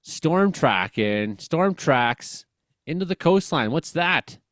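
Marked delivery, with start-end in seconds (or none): none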